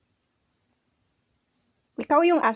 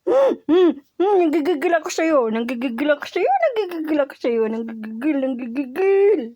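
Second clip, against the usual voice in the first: gushing pet talk; 4 semitones higher, 5 dB louder than usual. Real, not acted. Squeezing the dog in affection.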